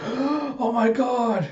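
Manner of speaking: high, drawn-out mock astonishment; shocked surprise. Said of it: "everyone just reacting surprised".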